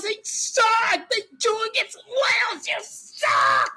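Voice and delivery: high, wailing mock rant; ranting fan. Streamer quotes a fan cursing his team, high mock wail. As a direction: imitate a fan's high-pitched cursing. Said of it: "is what he said".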